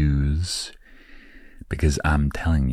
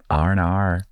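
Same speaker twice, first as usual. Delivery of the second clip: "R and R" in an American accent, higher, r's rolled in; 4 semitones higher than usual. Shown as a demonstration. Showing listeners the trick in an American accent. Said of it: "in an American accent".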